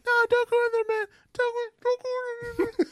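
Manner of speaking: high-pitched dummy voice, muffled and fading; Valentino. Retold or imitated. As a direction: squeal as if the car door shuts on you, trailing into a muffle. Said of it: "made the voice muffled".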